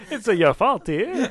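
slow, hammed-up Italian sing-song; Italian accent. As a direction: play up a comic Italian accent. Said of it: "You just turned Italian for a second".

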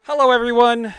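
big loud podcast welcome; announcer greeting. Acted. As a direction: boom out the hello.

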